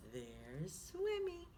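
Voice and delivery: ordinary delivery, nothing marked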